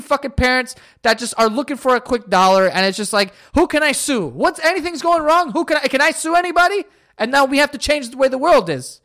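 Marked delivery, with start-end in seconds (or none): 3.5-6.8 high, fast whiny parent voice; mock greedy parent. Comedian mocking parents who want a payday, high and whiny. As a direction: mimic a parent hunting for someone to sue.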